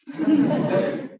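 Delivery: a burst of high breathy laughter. Real, not acted. Teacher laughing at students' morning snaps, high airy laugh.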